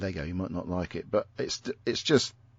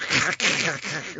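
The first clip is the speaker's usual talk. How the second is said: harsh hacking kkkk imitating Scouse throat sound; Scouse throat rasp; 6 semitones higher than usual. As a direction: hack out a gurgling throat noise. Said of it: "'cause they go".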